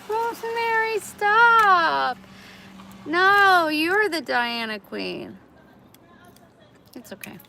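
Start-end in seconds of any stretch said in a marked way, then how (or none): 0.1-5.4 high, loud mock scolding of the cat; playful pet scolding. Real, not acted. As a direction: tease the cat to stop.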